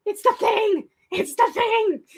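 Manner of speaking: excited shriek on the bus, trying not to laugh; fangirl squeal. Retold or imitated. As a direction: squeal like the thing finally appeared. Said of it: "I'm just like".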